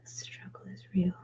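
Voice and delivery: soft whispered aside; whispering. Real, not acted. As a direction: whisper it to yourself. Whispered asides while working.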